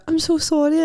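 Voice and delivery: high, flustered apology; mock embarrassed apology; apologetic. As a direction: gush an embarrassed sorry. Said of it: "And I was just pure".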